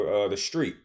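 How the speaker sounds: normal talking voice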